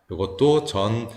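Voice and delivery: ordinary talk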